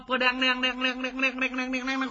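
rapid rolling syllables imitating hoofbeats; vocal hoofbeat clatter; imitated clatter. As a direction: rattle it off like galloping hooves.

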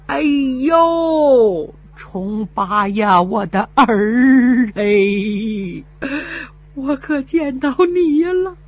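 put-on slow, quavering old woman's voice; an old mother weeping at her son's return; tearful old mother. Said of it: "老人老泪纵横啊". (老泪纵横的老母亲)

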